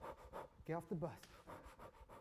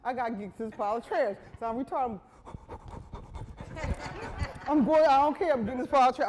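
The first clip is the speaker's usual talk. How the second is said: frantic rush; 5 semitones higher, 6 dB louder than usual. Retold or imitated.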